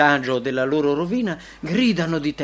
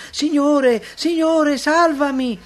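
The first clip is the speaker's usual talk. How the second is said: high, loud cry for rescue; desperate cry; 10 semitones higher, 6 dB louder, slower than usual. A narrator's reading. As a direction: cry out to your master as if drowning. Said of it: "su Gesù grida".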